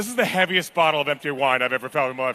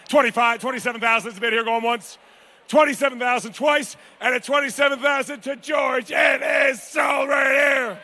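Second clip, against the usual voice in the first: going once, twice, sold; 6 semitones higher than usual. Acted. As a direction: bring the hammer down.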